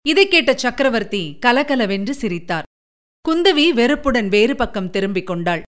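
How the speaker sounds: ordinary delivery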